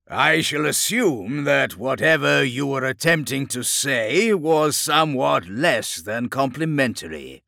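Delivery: mock him with grand amusement; amused monstrous warlord. A narrator's reading. Chaos lord mocking the captain's grunts, amused. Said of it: "Lord Gerlach laughed again".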